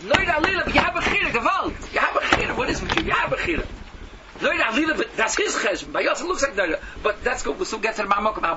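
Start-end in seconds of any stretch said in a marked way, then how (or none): 0.0-6.4 loud, high, insistent repetition; heated insistence. Real, not acted. The preacher insisting to a doubting listener, loud and high. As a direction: hammer the point home.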